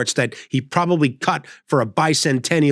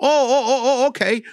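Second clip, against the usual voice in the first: put-on flustered old man; 9 semitones higher than usual. Elderly installer agreeing.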